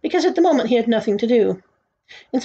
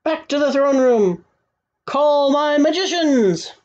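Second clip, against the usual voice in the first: higher, slow royal command; calling out orders; 6 semitones higher, 3 dB louder, slower than usual. A narrator's reading. King summoning his magicians, calling out grandly.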